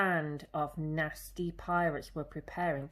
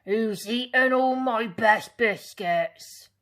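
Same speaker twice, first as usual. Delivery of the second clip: loud, slow outraged pirate demand; furious about his biscuits; indignant captain shouting; angry captain; 5 semitones higher, 10 dB louder than usual. Acted. Angry captain finds empty barrel.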